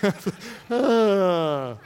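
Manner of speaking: drawn-out chuckle tailing into a sigh; laugh. Real, not acted. Pastor amused at his own sermon, chuckling. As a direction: laugh it out, then let it trail off.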